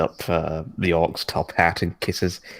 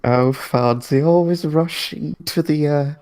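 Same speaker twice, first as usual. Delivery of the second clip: coy flirt; 8 semitones higher than usual. Acted. Sarix flirting back in character.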